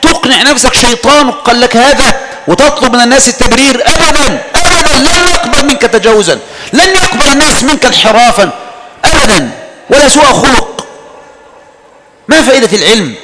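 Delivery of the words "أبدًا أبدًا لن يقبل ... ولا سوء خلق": high, forceful "أبدًا" hammered home; forceful preaching. Acted. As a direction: warn them sternly, never. Emphatic preaching warning. (تحذير حازم بصوت عال)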